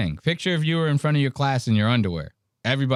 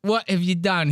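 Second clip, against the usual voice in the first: high-pitched mock-distraught Australian; lost Australian man; 6 semitones higher than usual. Comic mimics James asking what was done, lost Australian. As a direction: plead like a bewildered Aussie. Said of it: "He looks like the most lost man".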